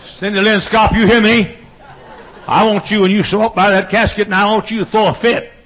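preacher calling out to his wife. Real, not acted. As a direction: call out across the church.

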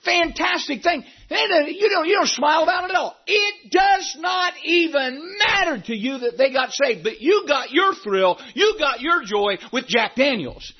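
shouted, high-pitched preaching build; full-force preaching. Acted. Pastor scolding the congregation for joyless faith, preaching at full force.

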